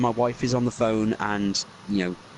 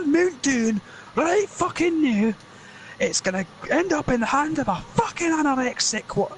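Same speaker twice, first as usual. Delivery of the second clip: angry Scottish rant. Acted. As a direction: rage at the elf in a squeaky Scots voice. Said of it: "Lord of the Rings rewritten by Scots".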